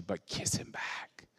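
soft slow whisper; hushed and slow, almost only breath; whispered plea. Real, not acted. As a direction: breathe it out like a secret. Intimate plea to congregation.

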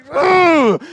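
high, loud groan; shouted mock-anguished groan; exasperated groan. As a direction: cry out in comic exasperation. Retold exasperated groan at the discovery.

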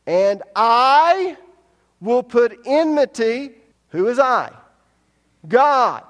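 very loud, high preacher's cry; shouted preaching. Acted. Preacher proclaiming God speaking to the serpent, shouting. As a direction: thunder God's words at the serpent.

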